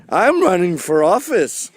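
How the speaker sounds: high, hammed-up politician voice; mock candidate. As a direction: announce it as an overblown candidate. Parodied candidate speaking up.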